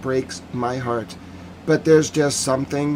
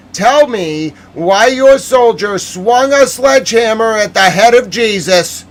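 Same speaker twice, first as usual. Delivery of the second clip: angry outburst; 9 semitones higher, 9 dB louder than usual. Real, not acted. Ranting at the forces over the desecration, loud and furious. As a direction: hurl the question at them in outrage.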